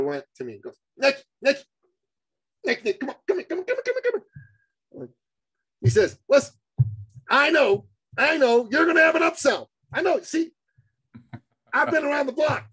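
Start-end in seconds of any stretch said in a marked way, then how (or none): 1.0-5.1 high, squeaky, insistent pleading; urgent beckoning. Retold or imitated. Workshop attendee calling the host aside, insistently. 6.3-12.7 high-pitched, emphatic know-it-all voice; knowing skeptic. Retold or imitated. Attendee confronting host about the upsell, knowingly.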